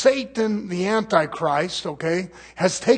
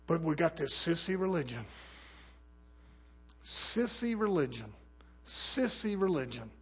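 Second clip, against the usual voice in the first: scornful hush; 12 dB quieter than usual. Acted. Sudden drop, scornful repetition.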